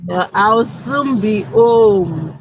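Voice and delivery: normal talking voice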